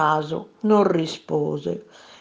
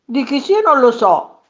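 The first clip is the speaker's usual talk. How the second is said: loud, raised retort; firm declaration; 5 semitones higher, 6 dB louder than usual. A narrator's reading. Fisherman answers the maid's claim, firm declaration. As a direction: declare it bluntly, pitched up. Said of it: "dichiarò il pescatore".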